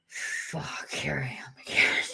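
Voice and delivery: unvoiced, weary whisper; whispered groan. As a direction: mutter it under your breath in dismay. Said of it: "And I'm like".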